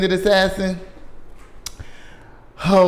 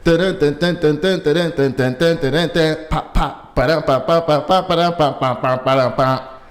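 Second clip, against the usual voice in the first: dun and pop beats done with the mouth; beatbox a little jingle; mouth sound effects. Pretend. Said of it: "vocal sound effects".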